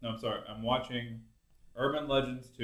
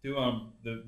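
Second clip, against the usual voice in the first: ordinary delivery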